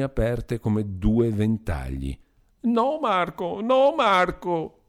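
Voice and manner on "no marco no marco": high slow shrieking pleas, much higher; shrill plea. A narrator's reading. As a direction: cry out begging him to stop. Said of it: "strillò la poveretta". (la poveretta strilla supplicando, acuta e lenta)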